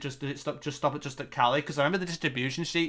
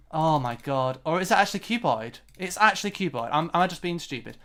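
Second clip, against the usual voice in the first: normal talking voice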